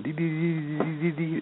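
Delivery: high, soft string of "dee" sounds; high-pitched, quiet babble of a busy mind; imitated busy-mind noise. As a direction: mimic mental chatter in a small high voice. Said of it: "mind that's not busy, not going".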